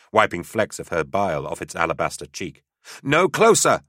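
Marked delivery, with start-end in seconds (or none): 3.0-3.8 high, loud desperate cry. Acted. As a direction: cry it out at the creature. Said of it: "she cried again".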